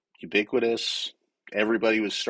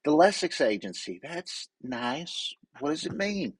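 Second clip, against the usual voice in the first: higher-pitched Georgia Southern twang; 7 semitones higher than usual. Retold or imitated. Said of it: "that good little Southern twang".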